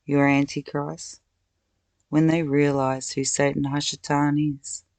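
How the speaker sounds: ordinary talk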